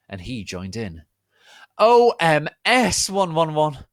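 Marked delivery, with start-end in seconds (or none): 1.8-3.9 high, loud, slow hammed cry; cried out. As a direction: wail it out theatrically. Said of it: "cried Vampire".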